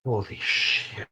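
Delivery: slow breathy whispered shock; awed whisper. Real, not acted. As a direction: gasp it out in awe. Awed by child's scary power.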